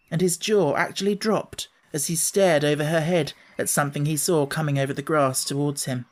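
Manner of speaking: plain reading voice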